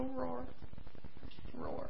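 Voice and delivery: tiny feeble roar; a roar with no power left; weak little roar. Acted. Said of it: "all he could do was go".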